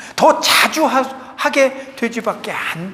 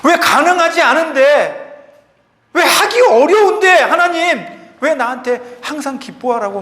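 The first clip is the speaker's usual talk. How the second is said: raised, higher voice of complaint; protesting to God; 6 semitones higher, 5 dB louder than usual. Acted. Believer asks God why demand constant joy, impassioned protest. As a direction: plead why, loudly, as if challenging heaven.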